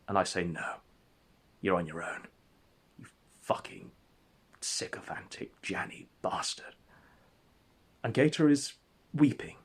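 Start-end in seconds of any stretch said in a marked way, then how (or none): 0.4-6.7 cold whispered insult. Acted. Narrator rejecting Gator, hushed whispered contempt. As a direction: drop to a hushed, scornful whisper.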